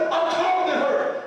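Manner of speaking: high, loud, whiny protest with a laugh in it; a mock-outraged kid demanding his rights; indignant teen son. Son complains to mom about his photo, high whiny mock outrage. Son's voice continues.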